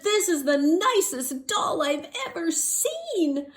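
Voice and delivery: high, slow delighted voice; gushing man. Acted. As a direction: marvel at the nicest doll. Man admires doll.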